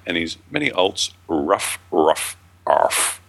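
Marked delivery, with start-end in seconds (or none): 1.3-3.2 mock little dog barks, ruff and arf; lightly voiced dog yapping; dog barking. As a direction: bark like a pet dog, half-playfully. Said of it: "Very good".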